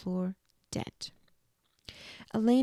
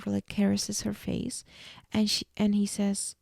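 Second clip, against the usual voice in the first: ordinary talk, nothing marked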